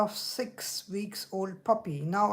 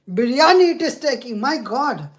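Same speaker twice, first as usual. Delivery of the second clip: loud, rushed complaint; exasperated; 3 semitones higher, 9 dB louder than usual. Real, not acted. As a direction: burst out at the useless machine. Assistant keeps mishearing her.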